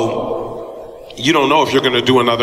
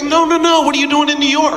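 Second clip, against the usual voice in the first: eager Halle; 13 semitones higher than usual. Retold or imitated. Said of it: "she was like".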